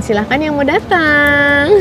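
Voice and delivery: ordinary talk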